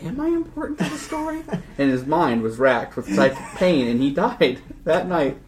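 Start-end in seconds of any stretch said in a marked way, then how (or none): 0.0-1.4 anxious inner thought. Retold or imitated. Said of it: "he thought".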